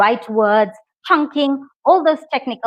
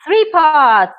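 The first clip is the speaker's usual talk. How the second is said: bright, louder, higher announcement of the answer; excited reveal; 6 semitones higher, 5 dB louder than usual. Real, not acted. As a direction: call it out like a quiz host.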